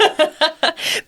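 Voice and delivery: a quick, high laugh; short laugh. Real, not acted. Guest laughing at her own promise to the host, a short laugh. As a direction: laugh it off lightly.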